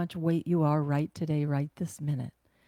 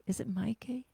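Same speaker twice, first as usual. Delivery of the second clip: whispered aside; 3 semitones higher, 5 dB quieter than usual. Real, not acted. Checking pronunciation aside.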